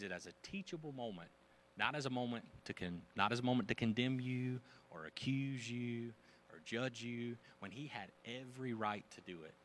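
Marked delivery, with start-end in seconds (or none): none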